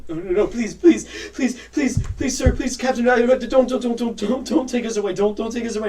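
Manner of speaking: high, repeated begging; acted desperate mother; frantic pleading. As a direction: plead in a panicked rush of repeats. Mothers beg not to be arrested.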